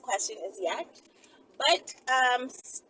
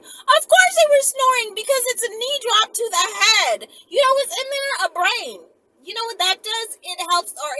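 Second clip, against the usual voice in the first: loud excited; 7 semitones higher, 10 dB louder than usual. Real, not acted. Ranting about a knee drop.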